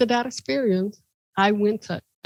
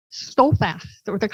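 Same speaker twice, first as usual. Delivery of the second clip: ordinary delivery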